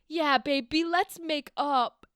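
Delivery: loud, drawn-out mock agreement; hammed-up lovey reply; slow down and gush it; smitten lover. Acting Brutus making up.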